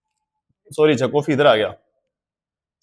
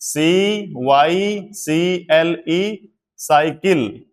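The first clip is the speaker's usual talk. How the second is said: spelling it out slowly; much slower than usual. Shown as a demonstration. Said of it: "से की sound देगा".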